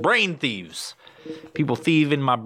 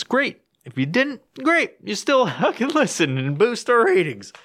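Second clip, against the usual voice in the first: mock cheer; 10 semitones higher than usual. Sarcastic cheer to listeners.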